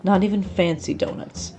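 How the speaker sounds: plain reading voice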